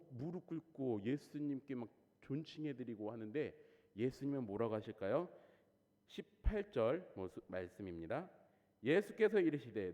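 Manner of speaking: ordinary talk, nothing marked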